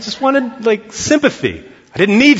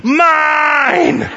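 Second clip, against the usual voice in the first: put-on high, crying toddler shout of Mine; toddler yelling; 10 semitones higher than usual. Toddler fighting sister over a doll, crying shout. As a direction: shriek Mine like a grabbing toddler. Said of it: "she just starts yelling".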